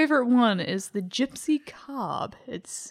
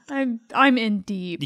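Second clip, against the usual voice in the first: usual speaking voice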